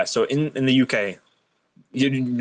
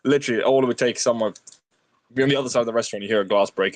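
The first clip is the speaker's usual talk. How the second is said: ordinary delivery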